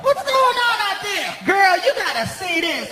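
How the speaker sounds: high call to a coworker. Retold or imitated. Clerk calling her coworker out back, high holler.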